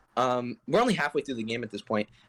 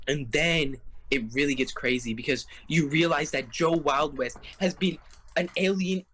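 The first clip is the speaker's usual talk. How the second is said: ordinary talk, nothing marked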